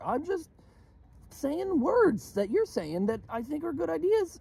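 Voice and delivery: high, strained pleading rant; exasperated pleading. Real, not acted. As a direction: protest your good intentions, voice pitched high. Pleading exasperated self-defense.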